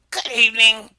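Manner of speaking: loud greeting; loud frog greeting. Acted. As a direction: greet the room boldly.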